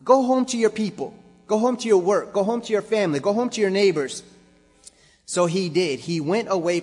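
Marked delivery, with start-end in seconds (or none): 0.0-4.2 high, loud call to go home; rally them with each line; preaching at full force. Acted. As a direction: pastor sending the church out, loud and high.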